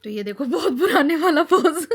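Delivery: high, loud laughter through the words; laughing. Real, not acted. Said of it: "मुझे इतनी हंसी आ रही थी".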